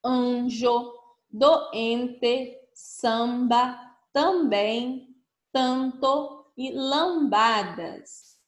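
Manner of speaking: each word read out slowly, syllable by syllable; teacher modelling a word list for children, slow and clear; slow model reading. Shown as a demonstration. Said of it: "Então, vamos ler as palavras".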